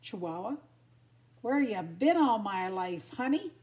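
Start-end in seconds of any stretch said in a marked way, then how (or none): 1.4-3.5 loud, flirty tease; playful bulldog come-on; teasing. A narrator's reading. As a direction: tease the newcomer, lifting up at the end. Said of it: "teased the bulldog".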